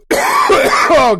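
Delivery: coughing fit mid-sentence; choking on the word. Real, not acted. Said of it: "I was choking there in the middle of it".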